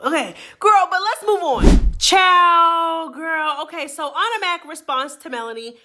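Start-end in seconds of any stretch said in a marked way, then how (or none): 0.0-3.6 loud sassy sendoff. Real, not acted. Sassy sign-off to viewers.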